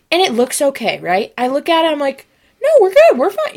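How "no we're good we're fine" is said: high, loud start on acted denial; mock-panicked "all good"; high-pitched self-reassurance. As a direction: insist it's fine in a squeaky high voice. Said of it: "when you're really hurt".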